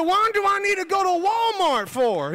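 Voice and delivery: loud, high incredulous question; baffled protest. Retold or imitated. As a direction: protest in comic bewilderment. Acts out baffled reply.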